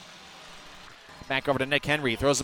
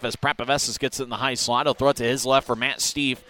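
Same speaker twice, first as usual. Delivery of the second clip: ordinary talk, nothing marked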